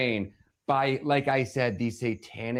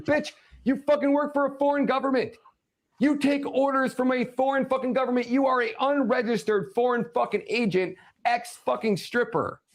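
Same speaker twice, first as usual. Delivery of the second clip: very high-pitched angry rant; real fury, voice pushed way up; furious tirade; 13 semitones higher than usual. Real, not acted. Ranter accusing a politician of serving foreign government, furious.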